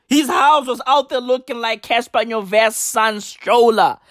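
ordinary delivery

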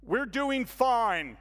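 slow, stiff, rehearsed delivery; reading a cue card. Retold or imitated. Churchgoer answering the newcomer as if from a cue card. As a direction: recite it like a memorized line. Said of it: "like he's reading from a cue card".

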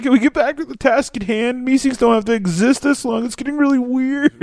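very high cartoon voice; Meeseeks voice. Quoting fed-up Meeseeks to a friend, high cartoon voice.

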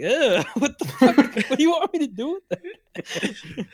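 mock disgust. Handed a hair ribbon by somebody, mock disgust. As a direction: protest through laughter, pitch climbing. Acting his own disgusted reaction.

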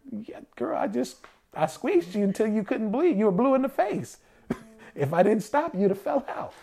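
high, comic exasperated voice, laughing at the end; mock exasperated partner. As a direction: play the worn-out partner defending himself. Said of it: "And you're like".

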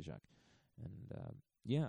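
ordinary talk

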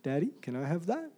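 soft, mock little boy voice; small child asking. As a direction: lightly voice a little boy begging. Son asking for the milk.

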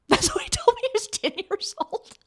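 high, squeaky with real laughter; helpless laughter. Real, not acted. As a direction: talk through a fit of laughing. Laughs confessing she laughed at son.

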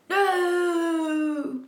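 long, high drawn-out cry; acted scream. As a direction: wail it out in mock agony. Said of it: "he screamed".

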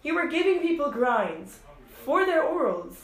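high, loud, deliberate retort; indignant disbelief. Retold or imitated. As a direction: spell out the outrage phrase by phrase. Acting out her indignant comeback.

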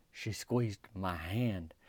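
very slow, soft and low, word by word; savoring repeat. Real, not acted. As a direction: linger on every word of it.

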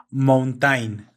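"mountain" read as spelled, sounding every vowel, "moun-ta-in"; spelling pronunciation. Shown as a demonstration. Speaker shows friend how the word is written, spelling pronunciation. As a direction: say "mountain" letter by letter the Spanish way. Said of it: "si lo, tú lo escribes es".

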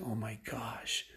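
slow, hushed whisper; whispered dismay. Retold or imitated. Girl finding the last blank page, whispering in dismay. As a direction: whisper it slowly, acting out a sudden realization.

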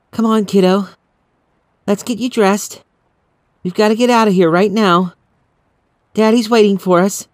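mother rushing her daughter out of the house; urgent mother. Acted. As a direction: hurry the child along gently. Mother urging child to hurry.

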